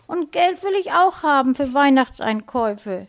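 put-on high, loud childish wail; sobbing little girl. A narrator's reading. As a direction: whine it as a tearful six-year-old. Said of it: "schluchzte sie".

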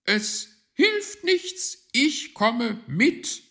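slow, very high, loud bird voice; high and emphatically drawn out; resolute magpie. A narrator's reading. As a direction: announce it loudly, word by word. Magpie resolves to come along.

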